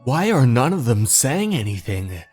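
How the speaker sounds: ordinary delivery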